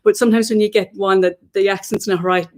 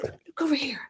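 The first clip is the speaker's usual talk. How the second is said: breathy whisper to the dog; 5 semitones higher, 11 dB quieter than usual. Real, not acted. Coaxing the dog.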